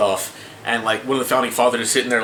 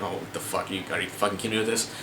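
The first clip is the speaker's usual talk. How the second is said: muttered disbelief; 7 semitones lower, 11 dB quieter, faster than usual. Retold or imitated. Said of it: "I'm like".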